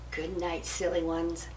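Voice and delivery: don't wake the sleepers; hushed good night. Acted. Old Bear to sleeping skunks.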